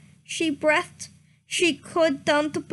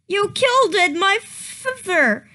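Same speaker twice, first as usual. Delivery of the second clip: higher, louder grieving yell; yelled accusation; 5 semitones higher, 3 dB louder than usual. A narrator's reading. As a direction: hurl it at the killer. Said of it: "she yelled".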